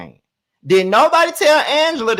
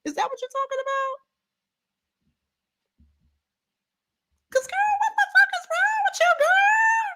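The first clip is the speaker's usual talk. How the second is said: much higher, fast shrieking outrage; high squealed outrage at Kay; screeching disbelief. Acted. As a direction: shriek it out, appalled. Keeps ranting at Kay.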